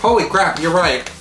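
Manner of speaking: usual speaking voice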